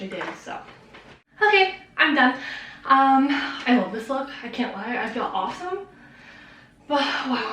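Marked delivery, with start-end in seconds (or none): 1.4-7.5 breathless after dancing; panting between words; out of breath. Real, not acted. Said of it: "I'm out of breath".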